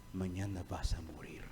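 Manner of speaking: hushed dire whisper. Acted. Preacher whispers Saul's doom to the congregation, whispering. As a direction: breathe the warning of death. Dramatic hushed warning of death.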